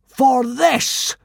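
loud, high solemn pronouncement; solemn boom. Acted. Fake god solemnly booming at the consul. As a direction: boom it gravely. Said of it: "boomed Hiccup solemnly".

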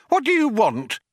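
high-pitched indignant demand; angry challenge. A narrator's reading. Programmer confronts the men who burst in, angry challenge. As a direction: challenge the intruders angrily. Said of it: "rising angrily from his seat".

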